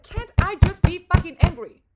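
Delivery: high, very loud frustrated shout; angry outburst. Retold or imitated. Demanding the right to be angry, full shout. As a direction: yell it out in exasperation. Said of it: "when I'm angry".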